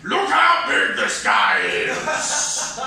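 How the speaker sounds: loud, high, drawn-out holler; bellowed slogan. Acted. Voicing a big-and-tall graphic tee, bellowed slogan. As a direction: boom it like an obnoxious T-shirt.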